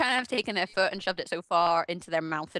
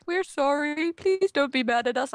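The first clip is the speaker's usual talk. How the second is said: high, louder, grovelling apology; mock pleading; 7 semitones higher, 4 dB louder, slower than usual. Developers begging angry fans on Facebook, mock pleading. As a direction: plead pathetically, mocking the apologiser.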